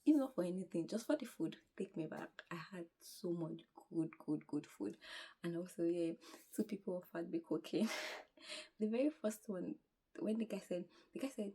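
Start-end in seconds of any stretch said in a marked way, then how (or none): none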